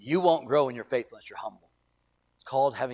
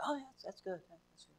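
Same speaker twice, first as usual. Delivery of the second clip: high, soft placating oh yeah; polite humoring; 5 semitones higher, 13 dB quieter, faster than usual. Retold or imitated. Preacher brushing off a listener, high soft polite placation. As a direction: humor them without meaning it.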